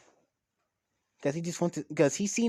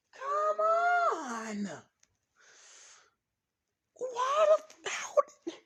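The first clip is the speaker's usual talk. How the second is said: very high, strained wail; exasperated screech; 3 dB louder, much slower than usual. Retold or imitated. Issei despairing over the loss, high exasperated screeching. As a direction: wail it, then break off speechless. Acting out Issei's outburst at losing.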